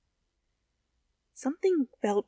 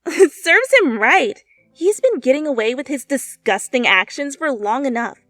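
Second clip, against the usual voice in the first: gleeful laugh; 6 semitones higher, 7 dB louder than usual. A narrator's reading. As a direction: gloat over the bully's downfall. Girl gossiping with a laugh.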